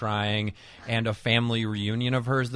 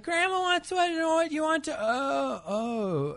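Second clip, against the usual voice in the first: put-on much higher child voice; kid asking; 9 semitones higher than usual. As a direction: innocent kid with a menu question. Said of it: "being like".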